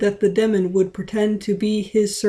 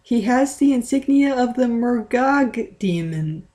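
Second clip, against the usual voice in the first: yelled; 5 semitones higher than usual. A narrator's reading. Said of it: "she yelled".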